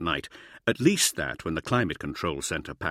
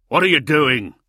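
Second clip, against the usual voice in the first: loud, raised-pitch angry demand; yell; 6 semitones higher, 9 dB louder than usual. A narrator's reading. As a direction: yell it across at someone. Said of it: "Brom yelled".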